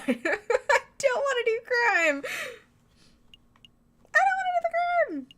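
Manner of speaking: high, loud fake-crying whine; mock wailing. Player dreading in-game theft, hammed-up high wail. Comic tantrum at having to steal.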